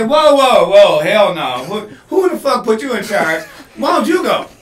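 loud, laughing "hell no"; mock outraged refusal. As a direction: push back hard, half laughing. Acting out refusing the bomb job.

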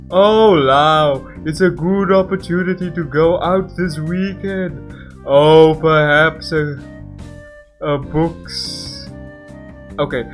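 loud, bright pleading invitation; eager character voice. Acted. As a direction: coax her warmly to come out. Voiced game character asking.

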